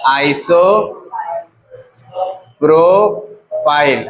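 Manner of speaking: Isopropyl stretched very slowly, syllable by syllable; very slowly drawn out. Shown as a demonstration. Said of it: "तो चार पर क्या लिखेंगे?". (Isopropyl बहुत धीरे खींचकर बोलना)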